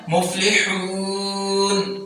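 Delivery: مفلحون ending on a still ن, softly and slowly; still final n. Shown as a demonstration. Teacher shows the pause ending. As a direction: stop dead on the ن. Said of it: "آخری حرف کو ساکن کریں گے".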